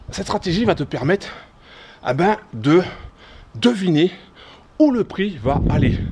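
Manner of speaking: usual speaking voice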